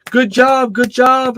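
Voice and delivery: high, mocking imitation of jeering players; mocking cheer. Other team gloating over an injured girl, high mocking cheer. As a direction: sneer it up high like gloating opponents. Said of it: "literally laughing".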